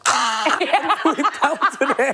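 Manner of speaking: laughing cry. Real, not acted. Fleeing the scene laughing.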